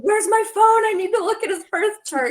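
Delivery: high, loud mock yell at a husband; retold yell. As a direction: re-enact yelling urgently for the phone. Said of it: "yelling at my husband".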